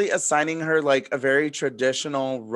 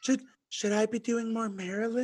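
soft high mock line; mocking Karen; 6 semitones higher, 7 dB quieter than usual. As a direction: mimic her limp delivery. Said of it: "mocking Karen".

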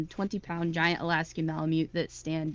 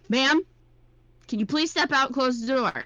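louder, higher official request; polite cop; 7 semitones higher, 6 dB louder than usual. Retold or imitated. Cop asking the woman to step out, louder and higher. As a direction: ask it like a firm but polite officer. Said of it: "He's like".

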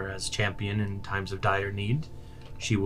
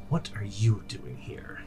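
slow, breathless hiss of alarm; hissed whisper; 6 dB quieter, slower than usual. Acted. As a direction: hiss it at the intruder, barely voiced. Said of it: "the Fang hisses out".

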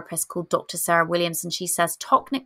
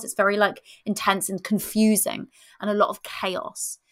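ordinary talk

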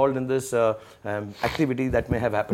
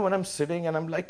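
ordinary delivery

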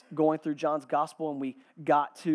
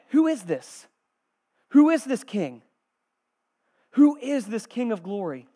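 raised, loud questions with pauses between; wondering Israelite; 6 semitones higher, 11 dB louder than usual. Acted. First-century Israelite seeing the king arrive, insistent questioning. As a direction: ask it forcefully, one question after another. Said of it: "you're like".

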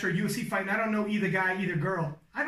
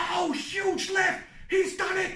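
high-pitched, amped-up lift call; thrilled sportscaster crying out in amazement; excited commentary; 8 semitones higher, slower than usual. Acted. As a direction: call it like you're on your feet. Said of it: "I was standing up, being like".

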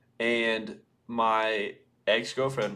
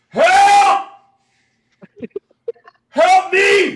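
shrieking help at full volume; yelling help; 15 dB louder, much slower than usual. Retold or imitated. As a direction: scream it across the bar. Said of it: "look at each other and go".

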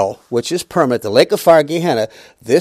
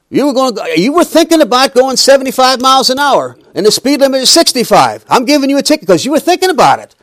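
mock cop voice, higher and louder; stern police officer; 9 semitones higher, 6 dB louder than usual. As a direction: play the officer handing out a ticket, half hammed-up. Police officer pulling pastor over.

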